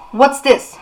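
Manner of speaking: put-on a young hunter demanding answers. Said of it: "he demanded".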